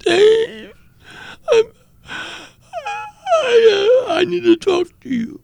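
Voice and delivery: high, loud, sobbing cry through the words; crying on the phone. Retold or imitated. Brother calling in tears, begging to talk, sobbing. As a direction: break down sobbing as you plead for help. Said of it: "he was crying profusely".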